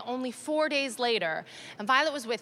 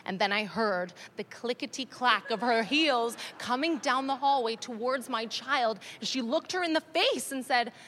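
ordinary talk, nothing marked

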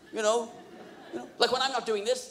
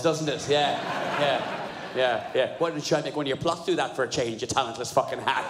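ordinary talk, nothing marked

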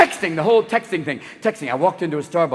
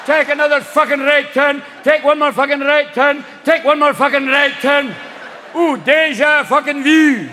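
loud, high, cursing Scottish sat-nav; Scottish GPS; 7 semitones higher, 4 dB louder than usual. Retold or imitated. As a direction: bark the directions in a furious Scottish accent. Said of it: "I want a Scottish G P S".

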